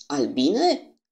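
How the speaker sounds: a booming, thunderous question; thundering voice. Acted. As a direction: thunder the word out like a storm. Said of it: "cu o voce ca de tunet".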